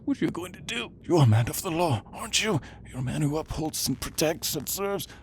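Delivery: high, anxious pleading; panicked appeal. Acted. Captive appealing to a man of the law, panicked appeal.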